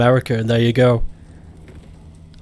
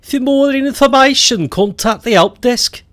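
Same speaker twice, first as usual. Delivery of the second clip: Birmingham accent; 11 semitones higher than usual. Shown as a demonstration. Demonstrating Brummie with a help desk line, high Birmingham accent. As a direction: whine it high in broad Brummie. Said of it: "Do you know what a Birmingham s-, accent sounds like?".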